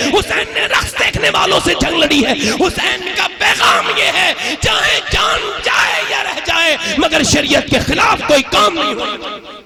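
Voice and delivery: high, shouted sermon climax; full-force shouted sermon; fiery preaching. Real, not acted. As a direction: preach it out to the crowd.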